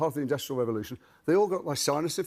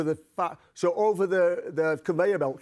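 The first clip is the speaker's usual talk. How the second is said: Yorkshire over-articulation; 3 semitones higher, slower than usual. Shown as a demonstration. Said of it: "Can you over-articulate like that?".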